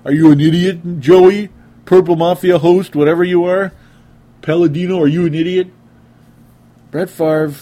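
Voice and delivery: loud, high mock heckler; scornful listener. Host voicing listeners who will call him an idiot, scornful mockery. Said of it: "some of you are gonna be like".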